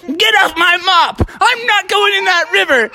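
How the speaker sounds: high, agitated mock yell; panicky protest. Smee to the turtle on his mop, high indignant yell. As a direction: shout off an attacker.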